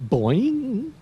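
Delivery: high, springy boings; bouncing boing. Pretend. Teacher making kangaroo bounce sounds for kids. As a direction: bounce your voice like a kangaroo hopping.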